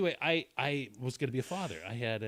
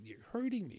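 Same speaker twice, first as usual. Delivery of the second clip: high, mock cry of pain; imitating hollering; 10 semitones higher than usual. Girlfriend crying out at the nurse, a mild high mock holler. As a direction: pitch the first plea up like a half-hearted yelp. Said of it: "she starts hollering".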